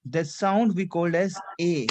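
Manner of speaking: "A" as a closed French é, ay; acute-accent E. Shown as a demonstration. Teacher explains accent towards right hand side, acute-accent E. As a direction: say "A" as a tight ay vowel. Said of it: "right hand side".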